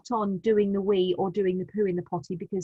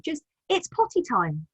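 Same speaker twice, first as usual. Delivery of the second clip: put-on bright, higher, cheerful announcement to a toddler; happy potty time; 7 semitones higher than usual. Shown as a demonstration. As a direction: make potty time sound like a treat. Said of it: "Make it very sort of happy".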